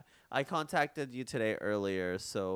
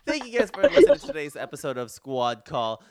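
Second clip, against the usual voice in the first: louder, upbeat podcast sign-off; energetic outro; 3 semitones higher, 14 dB louder than usual. Shown as a demonstration. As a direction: restart it with host energy. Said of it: "Let me start over".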